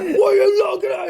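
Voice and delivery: high, loud imitated scream; mock furious teenage yell. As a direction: mimic an enraged kid picking a fight. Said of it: "fucking screaming at us".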